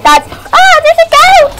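real startled yelp, high and loud; scared. Real, not acted. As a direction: jump in fright at the goat. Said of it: "Sorry, I got scared".

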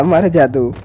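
ordinary talk, nothing marked

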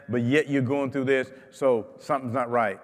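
ordinary talk, nothing marked

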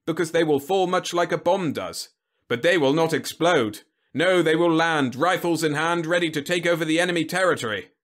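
loud, high-pitched rallying general; blustering general. Acted. General selling human bombs to Whitlow, emphatic. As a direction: declaim it like a proud commander.

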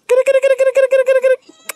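very high rapid egging on; excited urging. Real, not acted. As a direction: egg the animal on, squealing.

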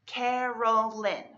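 Carolyn drawn out slowly in three even beats; syllable by syllable. Shown as a demonstration. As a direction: split Carolyn slowly into Ca-ro-lyn. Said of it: "has three syllables".

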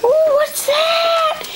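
mock excited gasp. Real, not acted. Showing dogs presents.

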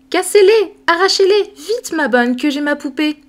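high, loud impatient demand; a little girl too eager to wait; girl impatiently urging her nurse; impatient child. Acted.

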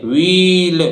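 the common mistaken "व्हील"; wrong pronunciation. Shown as a demonstration. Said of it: "नहीं, गलत उच्चारण है".

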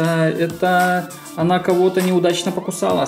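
ordinary delivery

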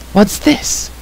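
high-pitched, astonished repeat; startled cry. A narrator's reading. Bard crying out at news of hosts, astonished. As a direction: jump the pitch up in surprise. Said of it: "cried Fluter".

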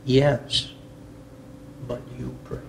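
very soft, spaced-out pronouncement; hushed divine voice, falling away softly; voice of God. Retold or imitated. God answers a crying man, hushed voice of God. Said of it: "the voice said this".

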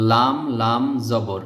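letter names spelled out slowly; drawn-out spelling for beginners. Shown as a demonstration. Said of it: "লাল বলতে হবে". (ধীরে বানান করা)

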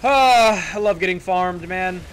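loud, high ironic complaint; loud sarcastic frustration; sarcastic outburst. Real, not acted. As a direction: groan it out as mock delight.

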